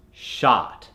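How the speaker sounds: shot with a long open "ah"; American accent. Shown as a demonstration. Coach converts example words into American accent. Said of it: "to the American accent".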